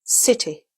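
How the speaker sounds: 'city' stressed on CI, falling away; stress on first syllable. Shown as a demonstration. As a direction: say 'city' as CIT-ee. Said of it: "it's the first syllable which is stress".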